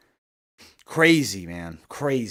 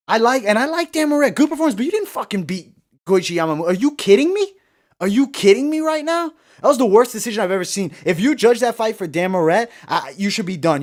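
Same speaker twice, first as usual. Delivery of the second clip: high-pitched, loud indignant protest; pissed off; 7 semitones higher, 5 dB louder than usual. Real, not acted. Streamer protesting the judges' decision to viewers, pissed off. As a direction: protest in heated disbelief. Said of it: "I'm pissed right now".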